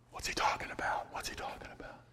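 hushed, confused whisper; puzzled whisper. Retold or imitated. Disciples muttering to each other, hushed whisper.

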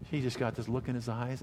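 ordinary talk, nothing marked

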